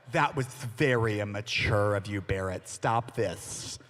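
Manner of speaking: low, stern mock-mom reprimand; scolding mom. Mom scolding her son for the prank, mock stern. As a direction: imitate a mother firmly telling off her child. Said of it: "my mom would be like".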